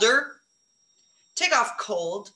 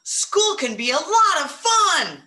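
higher and louder, full of excitement; excited; 7 semitones higher, 4 dB louder than usual. Shown as a demonstration. Teacher modelling an excited sentence for her class, excited. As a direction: sell the exclamation point to the class. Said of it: "With an exclamation point at the end, 'cause you're excited".

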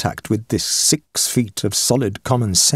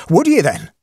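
high, loud Irish challenge; Irish accent; 9 semitones higher, 4 dB louder than usual. Acted. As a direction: press him in an Irish brogue. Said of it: "he cried in his own unmistakable accents".

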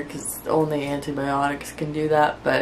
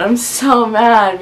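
mild frustration; 5 semitones higher, 6 dB louder, slower than usual. Real, not acted. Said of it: "I'm just mad".

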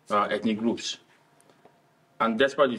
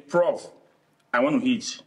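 Nigerian Pidgin. Shown as a demonstration. Showing how he'd tell Prof in Pidgin, Nigerian Pidgin. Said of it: "If I speak the Pidgin English now".

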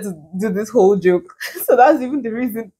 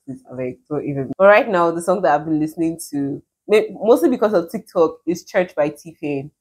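usual speaking voice

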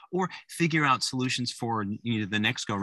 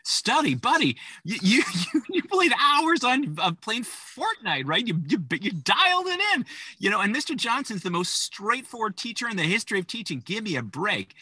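higher and louder, mock-exasperated; exasperated parent; 11 semitones higher, 4 dB louder than usual. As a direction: roll your eyes at the kid's excuses. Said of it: "you're thinking".

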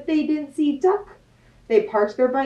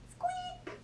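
a tiny, very high, much softer "Squeak"; mouse squeak; 21 dB quieter than usual. Acted. Mouse answering Duck with a tiny high squeak. Animal noises in picture book.

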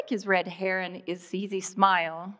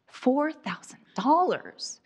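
incredulous echo, pitched higher; shocked disbelief; 6 semitones higher than usual. Acted. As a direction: repeat the price in stunned, high-pitched disbelief. Shocked repeat of mechanic's price.

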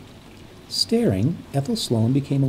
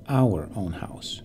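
slow, breathed whisper; whispered slowly, almost to herself; 3 semitones lower, slower than usual. Acted. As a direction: hush it to yourself, tenderly correcting. Correcting herself in thought.

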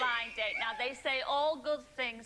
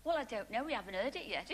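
ordinary delivery

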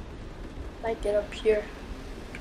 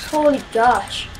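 ordinary talk, nothing marked

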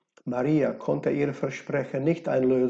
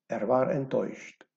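usual speaking voice

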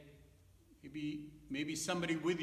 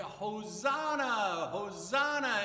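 loud, drawn-out parade cheer; crowd cheer; 4 semitones higher, 6 dB louder, slower than usual. Acted. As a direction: cheer like the crowd welcoming a king. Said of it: "they were cheering for him".

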